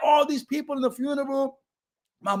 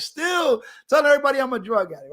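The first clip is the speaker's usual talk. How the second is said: normal talking voice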